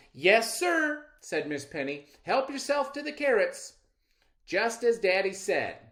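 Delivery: trusting and eager to please; little girl welcoming the stranger; naive little girl. Acted. Girl agrees.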